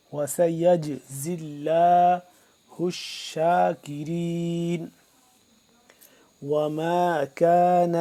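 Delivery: slow, drawn-out tajweed chanting; measured Quranic recitation. Shown as a demonstration. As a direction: recite each word with long held vowels. Teacher recites Quran verse.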